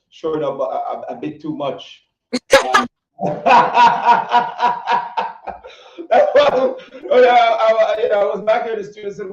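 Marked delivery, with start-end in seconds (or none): 3.2-6.0 high, loud burst of laughter; real laugh. Real, not acted. Host apologizing for a racy video, bursting into laughter. 6.1-9.3 amused, laughing. Real, not acted. Host still amused after her laughing fit.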